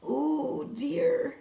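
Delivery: usual speaking voice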